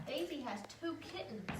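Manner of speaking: usual speaking voice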